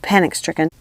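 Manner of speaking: ordinary delivery, nothing marked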